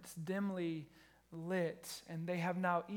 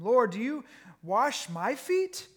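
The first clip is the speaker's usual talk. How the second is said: 1 surprised question; 5 semitones higher, 10 dB louder, slower than usual. Acted. Earlier reading of same line.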